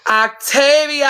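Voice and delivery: high drawn-out name call; excited call. Real, not acted. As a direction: sing out the name slowly. Calls out viewer's name.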